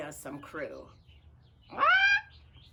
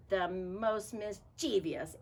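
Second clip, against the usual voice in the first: usual speaking voice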